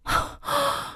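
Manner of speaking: sharp breath of disbelief; gasp, stunned by the nerve; incredulous gasp. Acted. Wife hearing the mistress's confession, incredulous gasp.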